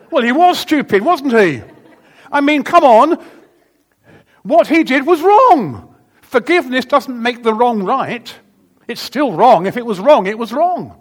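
loud, high emphatic sermon delivery; fiery preaching. Real, not acted. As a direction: hammer the point home to the congregation. Preaching at full force.

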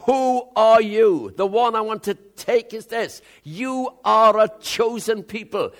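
fervent sermon climax; full-force preaching. Acted. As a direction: drive it into their spirit.

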